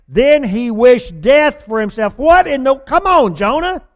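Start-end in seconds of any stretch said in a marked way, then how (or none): 2.2-3.8 high, loud what-in-the disbelief; exasperated outburst. Real, not acted. Preacher scolding Jonah's death wish, high and exasperated. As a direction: throw up your hands at Jonah.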